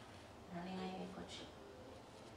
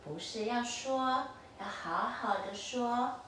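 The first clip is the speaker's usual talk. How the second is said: slow, gentle; slower than usual. Shown as a demonstration.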